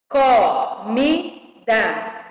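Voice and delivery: very slow "Comida", co-mi-da in separate beats; syllable by syllable. Shown as a demonstration. Teacher giving a three-syllable example, syllable by syllable. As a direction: count out each syllable of "Comida". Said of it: "Três sílabas".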